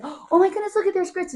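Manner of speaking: high, loud delight at the grits; excited squeal. Retold or imitated. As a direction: gasp it out like finding treasure. Excited shout on seeing grits.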